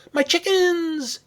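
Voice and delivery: high, loud, drawn-out cry of alarm. Acted. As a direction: cry out loudly in high-pitched distress. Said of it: "Abby cried".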